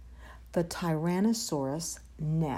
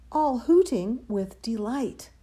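ordinary delivery, nothing marked

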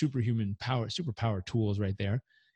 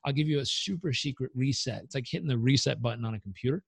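ordinary delivery

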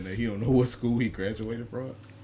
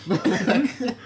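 laughing; 13 semitones higher, slower than usual. Retold or imitated. Laughing repeat of quote.

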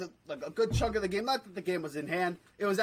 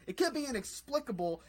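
ordinary talk, nothing marked

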